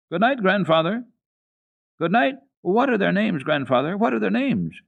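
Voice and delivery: put-on a small girl chasing after grandfather with questions; eager child calling. Heidi running after grandfather to ask the goats' names, high eager child voice. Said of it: "cried the child".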